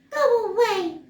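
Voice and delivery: very high, loud squeaky mouse; a tiny mouse shooing him off; squeaky little voice. Acted. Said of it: "said a squeaky little voice".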